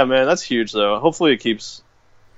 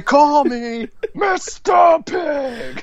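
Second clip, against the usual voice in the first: very high, very slow squeaky drawl with a laugh; squeaky goofy dog laughing; silly dog voice; much slower than usual. Pretend. Voicing dog.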